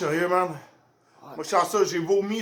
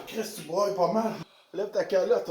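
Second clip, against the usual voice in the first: usual speaking voice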